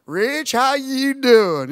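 slow big Texan drawl; imitating a Texan friend on the phone. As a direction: stretch it out like a big old Texan. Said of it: "He's just a big old Texan".

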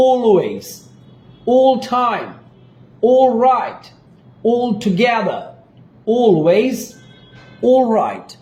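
long held "aw" opening each word; drawn-out vowel. Shown as a demonstration. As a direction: stretch the "all" in always, all time, all right, all together. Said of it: "একটু টান দিয়ে উচ্চারণ করতে হবে".

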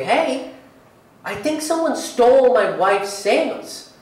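ordinary talk

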